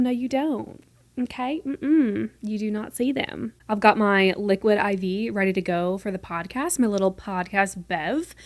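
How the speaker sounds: ordinary delivery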